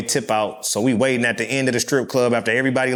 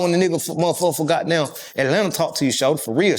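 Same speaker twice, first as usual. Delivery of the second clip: usual speaking voice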